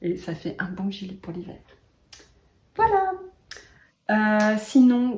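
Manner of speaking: usual speaking voice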